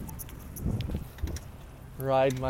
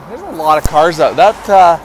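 shouting over traffic noise; loud; 2 semitones higher, 13 dB louder than usual. Real, not acted. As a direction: raise it over the cars.

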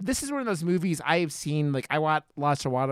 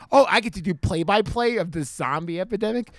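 higher-pitched imitation of an eager doctor; pumped; 6 semitones higher than usual. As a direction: act out giddy excitement, voice lifted. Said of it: "He was pumped".

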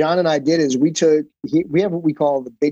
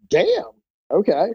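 a high squeaky mock-surprised damn, then okay; retold disbelief, pitch jumping up; 7 semitones higher, slower than usual. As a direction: act out being caught off guard. Retold reaction to big offer.

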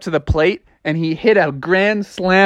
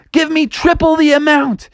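high-pitched eager frog; hyped-up little frog voice; excited demand; 12 semitones higher than usual. Acted. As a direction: demand it breakneck-keen, pitched up. Froggy rushes in demanding more.

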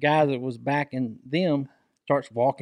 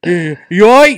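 loud, raised-pitch question; retold concerned check; 4 semitones higher, 11 dB louder than usual. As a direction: imitate a friend checking on you, voice up. Friend's retold worried question.

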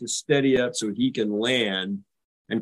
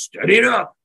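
booming, much higher command from above; captain's big God voice; 9 semitones higher, 5 dB louder than usual. Retold or imitated. Captain ordering the ship steady in a booming voice. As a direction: call it down like the voice of God. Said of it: "you hear this big God voice".

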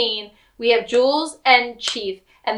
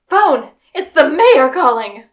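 gasp, then a raised, loud announcement; mock breathless urgency; 3 semitones higher, 9 dB louder than usual. Recapper plays somebody running into the room, mock urgent call. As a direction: burst in with urgent news, hammed up.

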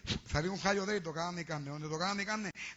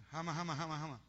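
lightly imitated stammering comedian; stuck on one syllable, mock speechless; tongue-tied comic; 3 semitones lower, 3 dB quieter than usual. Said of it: "el cómico ese". (cómico que se traba, imitado levemente)

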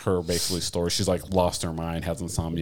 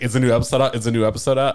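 raised, eager exclamation; higher, keen and giddy retelling; excited anticipation; 4 semitones higher, 5 dB louder, faster than usual. Retold or imitated. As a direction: act out weekly excitement for a new episode. Acting out weekly excitement for episode.

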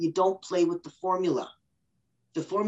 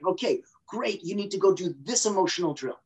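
ordinary talk, nothing marked

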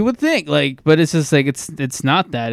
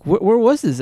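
incredulous; 9 semitones higher than usual. Retold or imitated. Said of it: "I was like".

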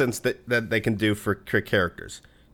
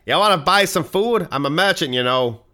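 hammed-up New England merchant, pitched up; New England accent; 5 semitones higher than usual. Merchant from the Stephen King area selling food, exaggerated New England accent. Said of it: "people from that region come in".

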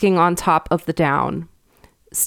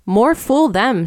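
rude schoolboy retort, higher and drawn out; 6 semitones higher, 4 dB louder, slower than usual. A narrator's reading. Stanley scoffing at the shepherd's warning, rude. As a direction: toss it back rudely, slow and pitched up. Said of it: "at once rudely and ungrammatically".

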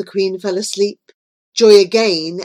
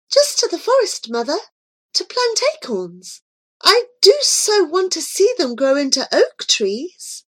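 put-on young girl; 10 semitones higher than usual. A narrator's reading. Joy answering the Queen.

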